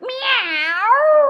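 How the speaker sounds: high, loud mewing cat; cat meow. Acted. Kitchen cat asking the crying chef why, meowing. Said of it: "the cat that was inside the kitchen said".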